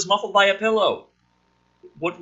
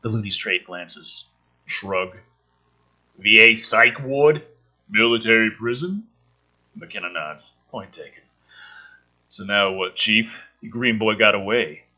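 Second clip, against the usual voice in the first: low, deadpan script read; screenplay reading; 10 semitones lower than usual. Acted. Reading the script aloud for viewers, low and deadpan. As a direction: read the scene out dry and low.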